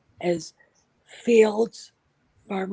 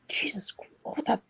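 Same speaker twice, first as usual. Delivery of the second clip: breathless disgusted whisper; shocked whisper; 3 semitones higher, 6 dB quieter than usual. Retold or imitated. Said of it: "and I'm like".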